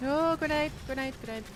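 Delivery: sudden high call of grenade; grenade warning yell. Real, not acted. Spots a grenade mid-fight.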